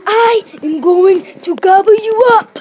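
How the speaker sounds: very loud, slow shout pitched high, not deep; a child's big monster roar; crocodile shout. Acted. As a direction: crocodile roaring the threat at the big turtle. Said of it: "shouted in a very deep voice".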